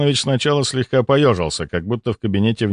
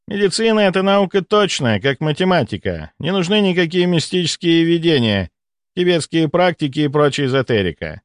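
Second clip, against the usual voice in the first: lightly voiced smug doctor, higher pitched; self-assured doctor; 7 semitones higher than usual. Doctor lectures his rival on exact science, self-assured. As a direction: lecture with raised pitch, sure of yourself.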